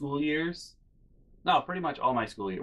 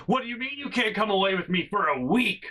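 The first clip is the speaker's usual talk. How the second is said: imitated family outburst; 9 semitones higher than usual. Family blowing up over trip plans, mock-angry yelling. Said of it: "legitimately a conversation I have with my family".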